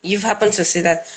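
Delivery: ordinary delivery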